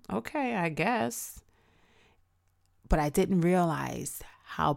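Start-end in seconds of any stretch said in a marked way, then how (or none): 0.1-1.4 unconvinced shrug. Retold or imitated. Retold lukewarm reaction.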